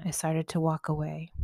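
usual speaking voice

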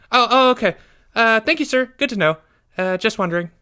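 put-on high-pitched, halting boy voice; sheepish schoolboy. A narrator's reading. Pupil retreating from teacher's warning, sheepish high voice. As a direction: back off awkwardly in a small high voice.

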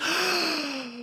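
high, exaggerated gasp; imitated gasp of wonder; mock awed gasp. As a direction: gasp like an amazed onlooker. Imitates everyone's reaction to the owl.

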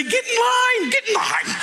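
very high, laughing shout; mock defiant shout. Pastor daring his critics, high laughing shout. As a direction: toss it back at the haters.

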